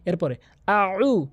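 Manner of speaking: আউ slow, ain pressed from mid-throat; tajweed demo of ain; drawn-out a'u. Shown as a demonstration. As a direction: stretch আউ from the middle of the throat. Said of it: "আউ বলতে হবে".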